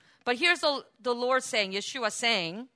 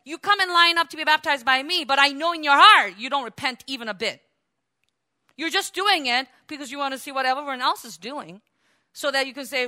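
loud, higher furious rebuke; angry yelling; 6 semitones higher, 8 dB louder than usual. Retold or imitated. John yelling at the Pharisees in line for baptism, angry. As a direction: yell it at them, cursing. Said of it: "he's yelling at them and cursing".

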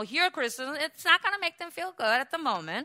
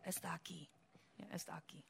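softer, breathy secret whisper; 6 semitones lower, 20 dB quieter than usual. Acted. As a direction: tell them the devil is here, in a whisper. Hushed line about the devil.

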